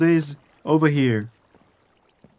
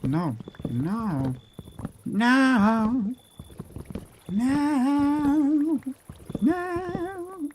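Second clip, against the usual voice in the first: each no climbs higher, into a squeak; a string of no going up into a high squeal; rising panic; 11 semitones higher, much slower than usual. Real, not acted. Panicking as build fails.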